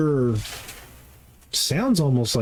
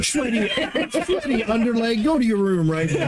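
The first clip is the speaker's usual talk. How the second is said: scolding parent voice; 9 semitones higher than usual. Acted.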